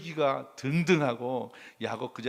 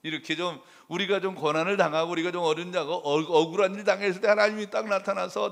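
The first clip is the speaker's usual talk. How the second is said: ordinary delivery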